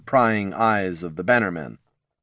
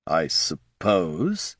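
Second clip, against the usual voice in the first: drawn out, lower and softer; slowly; 3 semitones lower, 3 dB quieter, much slower than usual. Acted. Barty mulling the question, slow and low. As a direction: let it stretch, thinking. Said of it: "he said slowly".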